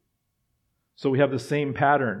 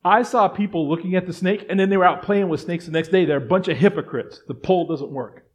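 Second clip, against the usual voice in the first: louder and higher, driving each question home; a rising pulpit fervor, louder and higher; fervent preaching; 5 semitones higher, 6 dB louder than usual. Acted. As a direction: preach it hard to the congregation. Preaching at full force.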